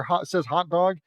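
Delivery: plain reading voice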